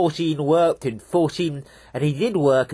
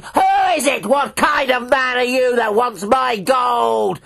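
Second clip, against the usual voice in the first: high, loud shrieking devil; furious devil shout; 8 semitones higher, 4 dB louder than usual. Acted. Devil shrieking down at fourteen from his throne, furious shout. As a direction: roar it down from the throne.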